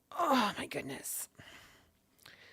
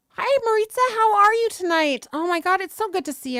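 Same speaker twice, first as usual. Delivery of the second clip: much higher and louder, bright hello to a chat friend; excited greeting; 10 semitones higher, 10 dB louder than usual. Real, not acted. Streamer greeting viewer Maritza in chat, excited high and loud. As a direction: greet her like you're thrilled she came.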